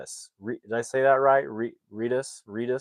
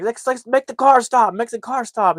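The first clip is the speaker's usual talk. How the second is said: high, loud mock panic; panicked shout; 13 semitones higher, 8 dB louder than usual. Family panicking as their car drives away, high shout. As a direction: yell at the runaway car to stop.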